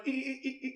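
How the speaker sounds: high, soft dolphin squeaks. Retold or imitated. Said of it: "the scientist went".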